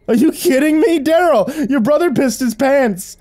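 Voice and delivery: high, loud mocking cry; jeering bully. A narrator's reading. Brother's friend mocking the younger boy's wet pants, jeering. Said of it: "blew out a raspberry of barely contained laughter".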